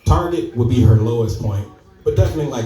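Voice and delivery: ordinary talk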